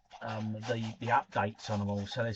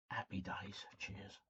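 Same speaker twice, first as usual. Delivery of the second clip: whispered toast; 5 semitones lower, 15 dB quieter, slower than usual. Real, not acted. Signing off a toast to viewers.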